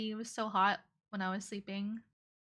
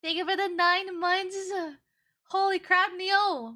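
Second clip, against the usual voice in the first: higher, louder delighted surprise; excited; 7 semitones higher, 4 dB louder than usual. Real, not acted. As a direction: burst out with happy surprise. Thanked for nine months sub.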